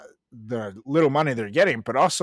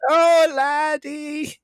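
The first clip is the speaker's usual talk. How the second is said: hammed-up Irish old lady, high and slow; Irish accent; 11 semitones higher, much slower than usual. Asked to redo the old lady's wish in an Irish accent. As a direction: lay on a thick comic Irish brogue. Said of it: "but with an Irish accent?".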